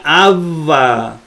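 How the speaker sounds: abba with a long, held b; wrong doubled consonant. Shown as a demonstration. Teacher listing how not to pronounce, abba drawn out. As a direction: linger on the middle consonant of abba. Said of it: "no se pronuncia".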